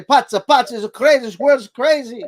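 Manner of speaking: put-on high, loud Italian-accented grandma exclaiming; Italian grandmother grumbling that the world is crazy. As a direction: exclaim like an old Italian nonna. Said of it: "She was speaking like this".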